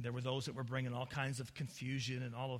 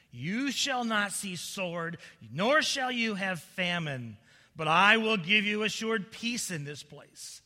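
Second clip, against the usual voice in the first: loud, slow, raised proclamation; declaimed false prophecy; 6 semitones higher, 12 dB louder, slower than usual. Acted. False prophets promise the people peace, loudly declaimed. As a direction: proclaim it grandly, stretching the words.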